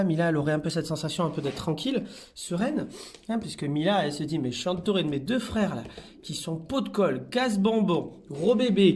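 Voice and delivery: normal talking voice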